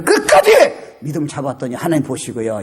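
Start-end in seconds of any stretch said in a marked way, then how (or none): 0.0-0.5 bursting out, high and punchy; determined cry. Retold or imitated. Preacher recalling how he held on to the end, determined cry. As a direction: push it out as a rallying cry.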